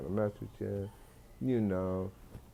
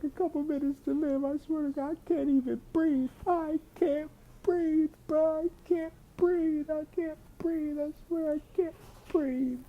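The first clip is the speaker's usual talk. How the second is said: much higher, squeaky panic, slowing and gasping at the end; high panicked voice. Retold or imitated. Caller on the phone panicking to him, high gasping squeal. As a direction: play it as a man sure he's dying, voice squeezed thin. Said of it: "She said".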